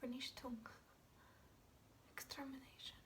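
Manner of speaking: soft, halting dread; quietly haunted, with a long pause; hushed horror. Acted. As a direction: let the word sink to near whisper. Dread word recalled over the victims.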